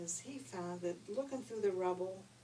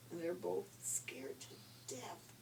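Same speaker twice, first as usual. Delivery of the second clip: plain reading voice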